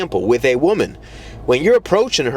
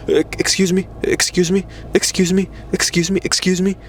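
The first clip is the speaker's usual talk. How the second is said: higher, timid repeated pleading; imitating a powerless guy seeking attention; mock weak interrupter; 5 semitones higher than usual. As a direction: meekly try to break into a meeting. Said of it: "how weak that shit makes you look".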